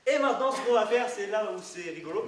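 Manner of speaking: loud showman build-up; kids party magician. Acted. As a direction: hype the next step for the crowd.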